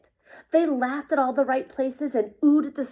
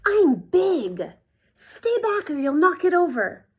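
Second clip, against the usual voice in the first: higher, louder and snappish; big brother warding off little sister, louder; bossy big brother; 7 semitones higher, 8 dB louder than usual. Acted. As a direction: push her away from the tower, loud. Felix guarding his tower.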